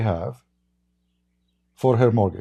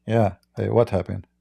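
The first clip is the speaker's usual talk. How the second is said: usual speaking voice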